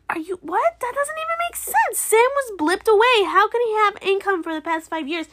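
high-pitched indignant protest; baffled, worked-up outrage; incredulous. Real, not acted. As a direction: protest the absurd logic, pitch jumping up. Incredulous at the banker's logic.

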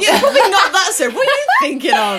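high, loud giggling talk; laughing. Real, not acted. Friends giggling about a rude meaning, laughing. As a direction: squeal it through laughter.

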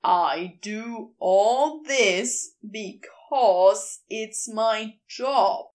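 every syllable spelled out slowly; enunciated. Acted. Crowley spelling his job out to Aziraphale, slow and deliberate. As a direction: lean on each syllable of "I do all this because it's my job". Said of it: "enunciating each syllable".

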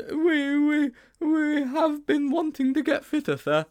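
put-on very high, halting boy's voice; high-pitched panicky schoolboy; nervous stammering. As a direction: fumble an excuse up in a high register. Sponge improvises excuse to teacher.

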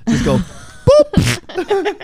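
squeaky high-pitched boop, drawn out slowly; a silly high slow boop. Pretend. Friend suggesting a finger poke, squeaky slow boop. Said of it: "Don't say words".

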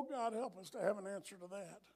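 ordinary talk

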